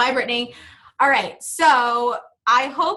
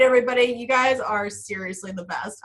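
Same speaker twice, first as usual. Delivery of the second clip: ordinary delivery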